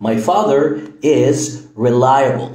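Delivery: My father is reliable, said very slowly and clearly; slow example sentence. Shown as a demonstration. Teacher reading the example slowly. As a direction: spell it out slowly for the learner. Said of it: "لما أقول لك مثلًا".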